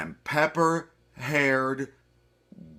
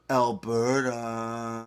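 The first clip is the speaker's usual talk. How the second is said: pointed aside; 3 semitones lower, much slower than usual. Acted. Pointed aside after fake cough.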